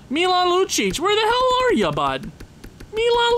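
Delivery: high, loud call across the room. Real, not acted. Gamer calling out for his missing player, high and loud. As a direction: yell out his name like you're hunting for him.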